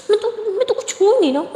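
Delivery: put-on high, pouty little-girl whine; sulky pout. Aliya sulking to Maya, high pouty whine. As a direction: sulk like a spoilt child.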